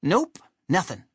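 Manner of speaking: high cartoon sidekick; Chuck. A narrator's reading. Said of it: "Chuck, who hadn't quite gotten".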